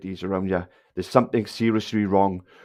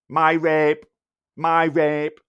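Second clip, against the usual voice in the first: put-on raised, loud childish chant; mocking little kid; 4 semitones higher, 4 dB louder, much slower than usual. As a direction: parrot him like a pestering kid. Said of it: "Little kid is what he is".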